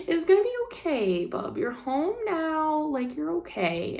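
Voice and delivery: soft, high, slow comforting; soothing reassurance. Retold or imitated. Wife reassuring frustrated husband he's home now, soothing. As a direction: coo it gently to calm him down.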